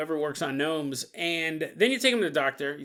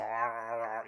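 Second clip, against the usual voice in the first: low, quiet growling; werewolf growl; 8 semitones lower, 9 dB quieter than usual. Acted. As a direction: growl softly while eating. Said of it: "He's all".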